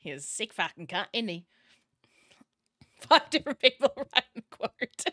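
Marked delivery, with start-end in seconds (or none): 0.0-1.5 lower, lightly mimicked British accent; mock a bad Aussie attempt that sounds British; imitated British accent. Host repeating a terrible Aussie accent, imitated British accent. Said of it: "Okay, that was British".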